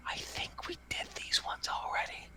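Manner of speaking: soft, unvoiced child's whisper; kid's stage whisper. Little girl secretly warning the leader, stage whisper. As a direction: whisper so everyone can hear, like a kid. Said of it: "you know how kids whisper".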